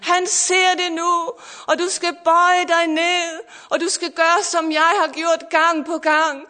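fervent preaching. Acted. Pastor preaching to listeners online, fervent and rising.

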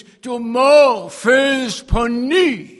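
slow, loud, high shout; booming proclamation. Acted. Preacher proclaiming Jesus's command to Nikodemus, booming slow shout. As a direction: thunder each word out slowly.

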